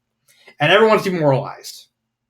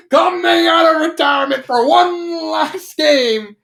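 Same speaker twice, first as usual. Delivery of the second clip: high, slow, booming hype call; hype shout; 12 semitones higher, 3 dB louder than usual. Retold or imitated. Hype crowd building Derpy up, booming shout. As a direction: shout it like an arena announcer.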